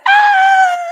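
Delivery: high piercing imitated scream, loud; mock a man screaming in jail, loud and high. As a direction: belt out a mocking shriek of pain.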